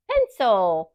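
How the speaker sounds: normal talking voice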